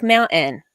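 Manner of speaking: "mountain" with no T, a catch before the last syllable; dropped T. Shown as a demonstration. Showing how people in Utah talk, "mountain" without its T. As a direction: say "mountain" as moun'-un, T swallowed. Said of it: "tendency to not pronounce their T's".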